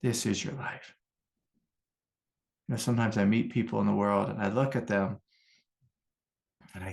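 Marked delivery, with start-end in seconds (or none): 0.0-0.9 a quieter, distant echo of the voice of God; softer echo of God. Retold or imitated. God repeating it to him, softly, like an echo. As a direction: repeat it softly as if from far away. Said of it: "God was like".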